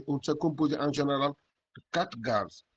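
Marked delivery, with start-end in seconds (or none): 1.8-2.6 blocked nose, a cold; stuffy nose. Real, not acted. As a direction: speak through a head cold. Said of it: "je suis un peu enrhumé".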